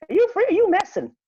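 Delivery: high, loud, disbelieving question; incredulous. Retold or imitated. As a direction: voice shooting up in disbelief. Acted disbelief at being insulted.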